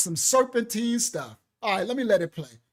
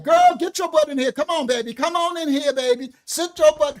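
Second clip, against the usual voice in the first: loud, higher rough grandma shout; grandma calling rough; 5 semitones higher, 7 dB louder than usual. Retold or imitated. Grandma calls the girl in, loud and rough. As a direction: bark it like a no-nonsense grandma. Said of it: "my grandmother didn't talk soft".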